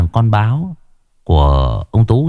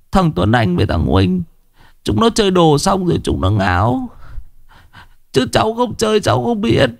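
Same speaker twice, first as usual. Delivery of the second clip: whimpering; 11 semitones higher than usual. A narrator's reading. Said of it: "mếu máo".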